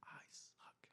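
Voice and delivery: unvoiced whisper of a dejected girl; whispered I suck. Daughter at skate park muttering about herself, whispered. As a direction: breathe it out, defeated. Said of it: "every single time she'd say".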